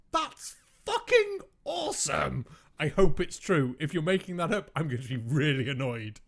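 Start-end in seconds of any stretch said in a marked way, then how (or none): 0.1-2.4 much higher, slow, thrilled exclamation; excited. Real, not acted. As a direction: voice leaps high with delight. Delighted by fighting monks.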